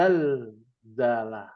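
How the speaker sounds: Az-Zalzalah very slowly, with a long doubled z and no l in the article; low, drawn-out teacher's model of Az-Zalzalah with doubled z; correct assimilated reading. Shown as a demonstration. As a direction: spell out Az-Zalzalah in slow syllables, holding the first z. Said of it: "yang sahih adalah".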